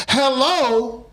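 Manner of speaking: put-on high, strained hello from a confused old lady; aunt who can't hear the phone calls out; old aunt's hello. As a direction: squeak it like a deaf old auntie.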